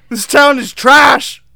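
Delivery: high, loud, drawn-out declaration; hammed-up loud condemnation; mock outraged shout. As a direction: shout it slowly as a comic verdict. Mock verdict shouted over the laughter.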